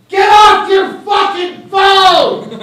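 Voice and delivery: high, slow shouted rebuke; movie character shouting. Acted. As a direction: scream at the phone user from the screen. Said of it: "some character just turned around and went".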